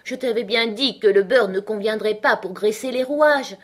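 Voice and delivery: angry reproach. A narrator's reading. Hatter blames the March Hare, cross and loud. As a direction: tell him off forcefully. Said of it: "d'un air furieux".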